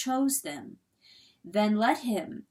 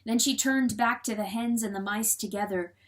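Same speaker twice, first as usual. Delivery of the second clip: usual speaking voice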